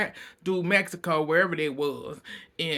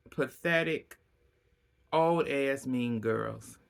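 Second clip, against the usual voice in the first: slow; 2 semitones lower, 3 dB quieter, slower than usual. Real, not acted. A slow, drawn-out insult.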